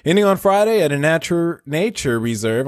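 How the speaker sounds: bright, high TV news anchor. Pretend. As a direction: read it like a cable news host. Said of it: "give my best news anchor voice".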